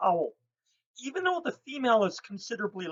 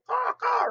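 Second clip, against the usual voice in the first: high loud crow cawing; crow caw imitation; 5 dB louder than usual. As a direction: squawk like a flock of crows. Crows raising a ruckus.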